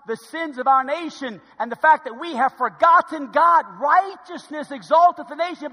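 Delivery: loud, emphatic sermon delivery; forceful preaching. Real, not acted. Preacher warning the congregation about the nation, forceful preaching. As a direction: drive each point home to the congregation.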